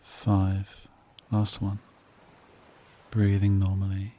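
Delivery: soft, unhurried meditation voice with long pauses; guided meditation. Real, not acted. Host guiding listeners to relax, calm meditation voice. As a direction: settle the listener into calm, phrase by phrase.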